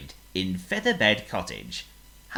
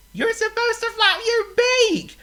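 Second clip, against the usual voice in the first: much higher, louder yes; a pig eager to explain; thrilled pig voice; excited pig; 10 dB louder than usual. Acted. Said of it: "called Oink".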